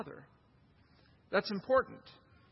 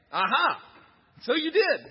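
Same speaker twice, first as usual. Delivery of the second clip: a mock gotcha, louder; triumphant aha; 5 semitones higher, 6 dB louder than usual. Mom catching her son's cookie lie, mock triumphant. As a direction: pounce like a mom catching a fib.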